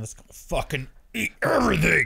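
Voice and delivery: growing loud, exaggerated rallying cry; hammed-up wild id voice; mock gleeful urging. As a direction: egg them on at full tilt. Id and ego urging bingeing.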